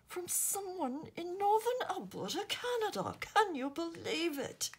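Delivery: high-pitched, amazed monarch impression with amateur British accent; King Charles. King calls Camilla over to admire a handmade card, amazed royal impression. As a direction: play a delighted royal, drawn-out and increasingly excited. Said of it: "King Charles is going to look at this and say".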